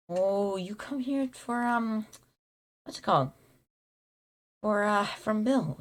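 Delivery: high, slow, puzzled stranger voice; Hill's voice. Retold or imitated. Hill greeting the visitor.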